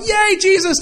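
a high, bright little cheer, light mockery. Pastor mocking David's happy prayer, high cheer. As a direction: squeal it like a happy fan. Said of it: "he goes from".

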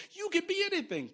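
lightly mocked chirpy teacher line; a hint of a cheery schoolteacher. Preacher mocking the teacher's pep talk, lightly sing-song. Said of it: "the teacher in school go".

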